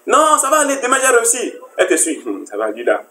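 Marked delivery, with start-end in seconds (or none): 0.1-1.5 high-pitched mock reassurance; carefree dreamer. Man who dreams of success tomorrow, mock carefree. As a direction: brush off worries in a raised voice.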